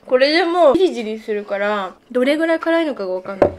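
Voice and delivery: muffled behind her hand; speaking while covering mouth; hand over mouth. Real, not acted. Seen 0: Hand covers her mouth from 0.8s, partway through the words.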